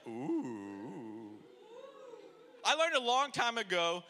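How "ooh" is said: ooh. Shown as a demonstration.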